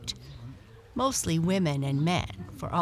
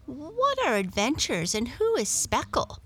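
higher, eager questions; curious cat; 8 semitones higher than usual. A narrator's reading. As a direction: Muffins asking about a new word. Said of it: "asked Muffins".

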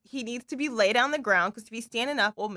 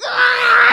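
startled yelp; 13 semitones higher, 10 dB louder than usual. Retold or imitated. Man nearly falls down hill.

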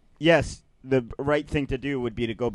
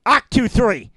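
barking drill sergeant; 9 semitones higher, 8 dB louder, slower than usual. Acted. Mock command to intern.

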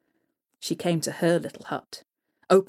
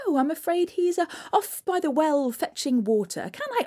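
mother; 9 semitones higher than usual. Acted. Mother fibs to the troll hag, high and nervous. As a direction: cover for your son.

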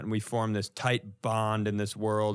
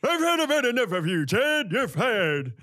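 put-on grumpy old puppet; 11 semitones higher than usual. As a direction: fire him like a cranky old puppet. Imitating Walter puppet.

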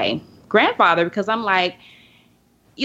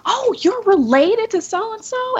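much higher, slow fake-sweet clerk voice; mock sugary clerk; slower than usual. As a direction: imitate her sudden syrupy turnaround. Clerk's sudden about face voiced.

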